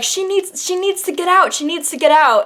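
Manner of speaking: high-pitched mock urgency; imitated crowd pleas. Retells crowd pleading for her exit.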